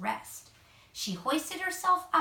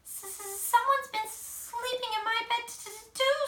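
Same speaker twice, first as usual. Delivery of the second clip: much higher, stammering cub voice; stuttering Baby Bear; 12 semitones higher than usual. A narrator's reading. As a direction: stumble over the s and t. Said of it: "stuttered Baby Bear".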